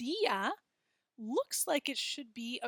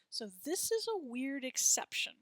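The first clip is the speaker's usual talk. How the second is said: ordinary talk, nothing marked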